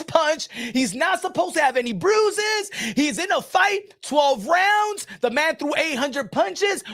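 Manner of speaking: high-pitched, exasperated protest; high mock-incredulous rant; mock indignation. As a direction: hammer out each point in mock outrage. Mocks complaints about a bruised boxer.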